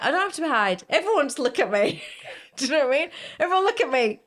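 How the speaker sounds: loud, high burst of self-display; exuberant declaration. Retold or imitated. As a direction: proclaim it to the whole room. Model declaring no need to hide.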